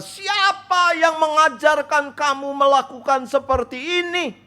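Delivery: high, loud scolding; snapping parents. A narrator's reading. Parents angrily scolding their son over the bribe. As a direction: scold him like furious parents. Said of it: "mereka membentak".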